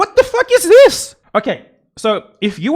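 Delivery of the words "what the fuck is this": high, loud incredulous yell; outraged outburst. Real, not acted. Streamer cutting off a clip in outrage, high incredulous yell. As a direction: burst out yelling in disbelief.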